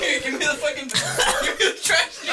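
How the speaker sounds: high, loud, wheezing laughter-cries; laughing crying. Real, not acted. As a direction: gasp it out between laughs. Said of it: "I'm crying".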